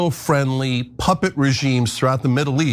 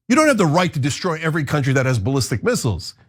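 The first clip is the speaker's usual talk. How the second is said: normal talking voice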